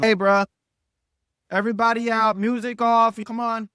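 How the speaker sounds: acted shout over the party; herding guests out. Acting out clearing guests out.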